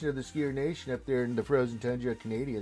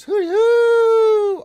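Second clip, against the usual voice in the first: very high, loud whooping call; 15 dB louder than usual. Acted. Viewer's whoop read out, very high loud hoot. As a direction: whoop it up at the top of your voice.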